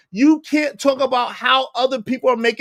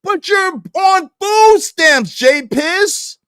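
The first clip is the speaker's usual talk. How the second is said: loud, slow, higher taunt; mocking shout; 6 semitones higher, 6 dB louder, slower than usual. Real, not acted. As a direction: shout it slowly, rubbing it in. Mocking rebuttal at J P.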